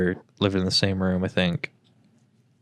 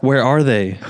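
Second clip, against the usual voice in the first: louder sleepy insistence; 3 semitones higher, 6 dB louder than usual. Retold or imitated. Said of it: "It's like".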